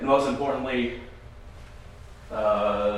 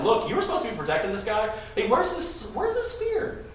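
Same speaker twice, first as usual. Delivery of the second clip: rising higher and higher in mock taunt; 10 semitones higher than usual. David taunting the sleeping guard Abner, high mocking voice. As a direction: tease Abner, climbing into a high sneer. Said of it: "he's gonna taunt Abner".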